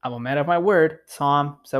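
normal talking voice

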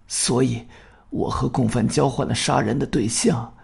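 soft, hoarse dying man. Acted. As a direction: rasp it out weakly. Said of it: "他的声音非常嘶哑". (声音嘶哑、虚弱)

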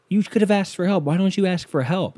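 scolding mother. Retold or imitated. Girlfriend's mother giving him the riot act, mock scolding. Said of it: "giving me the riot act like she's my mother".

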